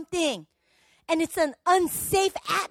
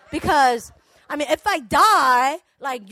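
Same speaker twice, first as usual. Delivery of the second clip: ordinary talk, nothing marked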